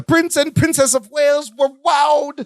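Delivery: high-pitched impression of a TV celebrity host; Robin Leach. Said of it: "we should do the line".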